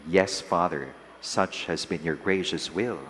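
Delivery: ordinary talk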